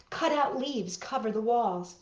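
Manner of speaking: ordinary delivery, nothing marked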